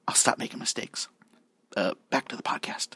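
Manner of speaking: soft unvoiced whisper. Real, not acted. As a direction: whisper it as an aside. Whispered aside in correction.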